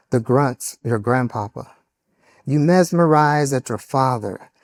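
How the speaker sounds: ordinary talk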